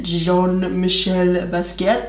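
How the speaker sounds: badly faked French accent; terrible French accent. Vlogger naming a pencil collaboration, in a bad French accent. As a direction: put on a clumsy French accent for the name. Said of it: "That's a terrible French accent".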